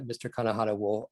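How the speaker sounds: usual speaking voice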